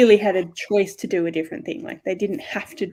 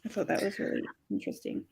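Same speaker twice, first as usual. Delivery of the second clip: whisper; 11 dB quieter, faster than usual. Real, not acted. Trailing comment, unvoiced.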